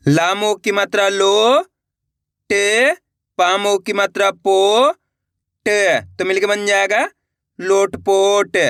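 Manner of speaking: slow, careful syllable dictation; slow spelling. Shown as a demonstration. Teacher sounding out a word for students, slow and careful. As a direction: sound out each syllable slowly, then blend. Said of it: "चलिए देखिए".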